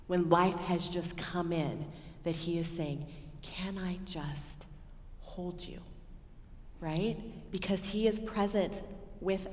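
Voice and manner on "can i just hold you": slow, much softer, fading into a whisper; tender whisper. Retold or imitated. The Father to a weary child, a slow tender whisper. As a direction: hold them close and breathe it out. God voiced comforting the weary.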